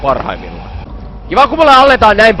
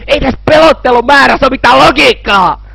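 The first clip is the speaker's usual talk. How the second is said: high, strained outburst; exasperated protest; 8 semitones higher than usual. Real, not acted. Player protesting illogical scares, exasperated high rant.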